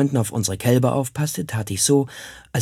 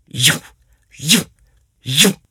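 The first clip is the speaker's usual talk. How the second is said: Peitschenhiebe nachgeahmt; 6 semitones higher, 7 dB louder, much slower than usual. Retold or imitated. Gertenhiebe lautmalerisch nachgeahmt.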